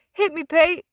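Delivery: high, loud call; calling for the ball. A narrator's reading. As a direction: demand the pass. Eli calling for pass.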